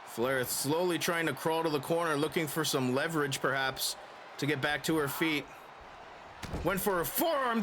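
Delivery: ordinary talk, nothing marked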